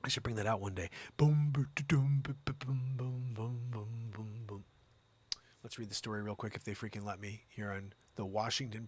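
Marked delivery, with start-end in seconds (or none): 1.2-4.6 double bass imitation. Host mimics his stand-up bass, booming and fading. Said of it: "Stand up freaking double bass".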